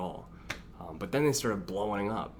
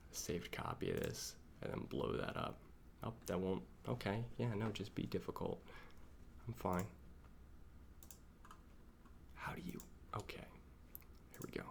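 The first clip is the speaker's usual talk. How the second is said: hushed muttering to oneself; near-whisper; 12 dB quieter than usual. Real, not acted. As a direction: keep it very soft, almost whispered. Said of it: "Why are you whispering".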